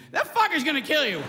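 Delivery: ordinary delivery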